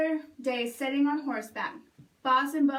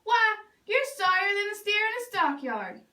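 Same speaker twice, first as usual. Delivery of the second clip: higher, jeering insult; mocking taunt; 7 semitones higher than usual. Stepbrother mocking Bubba's chances, jeering higher. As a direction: sneer down at Bubba. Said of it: "said Dwayne".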